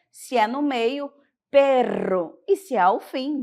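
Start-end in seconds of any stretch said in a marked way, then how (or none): 1.5-2.4 forcefully rolled RR in "perro"; Spanish "perro" with a strong rolled R. Shown as a demonstration. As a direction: trill the middle of "perro" hard. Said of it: "com força".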